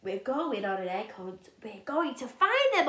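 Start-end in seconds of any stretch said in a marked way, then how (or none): none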